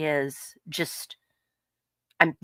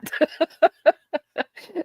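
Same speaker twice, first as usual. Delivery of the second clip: burst of laughter; 13 semitones higher, 5 dB louder than usual. Real, not acted. Laughing at her own mother story.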